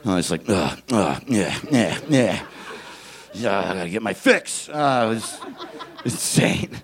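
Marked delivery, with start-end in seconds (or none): 0.5-4.7 lightly voiced twitchy junkie grunts; half-played addict muttering; juice addict. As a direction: grunt like someone needing a fix, mock desperate. Kids as addicts pounding juice.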